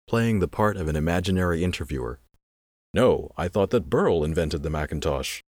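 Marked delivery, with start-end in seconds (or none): none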